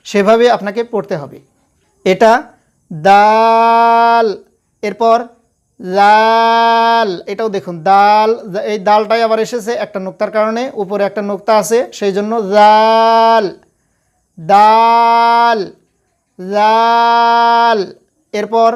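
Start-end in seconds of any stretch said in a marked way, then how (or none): 2.9-7.1 দাল held long and even; drawn-out recitation. Shown as a demonstration. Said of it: "যেভাবে পড়ছি আমি". 12.0-17.8 দাল held long and even; drawn-out recitation. Shown as a demonstration. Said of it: "যেভাবে পড়ছি আমি".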